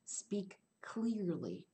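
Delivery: "Speak clearly" whispered, slow and deliberate. Shown as a demonstration. Teacher urges students to speak clearly, whispered slowly. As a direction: breathe it out slowly, word by word. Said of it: "whispered advice".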